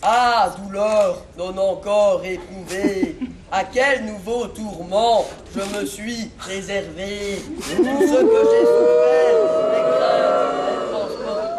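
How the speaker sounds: slow, wildly overblown tragic recitation; hammy stage actor. Acted. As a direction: ham up Racine, climbing into a shrieking falsetto. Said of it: "déclamer d'une manière très fausse". (déclamation ampoulée et outrée de tragédien)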